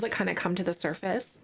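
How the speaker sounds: usual speaking voice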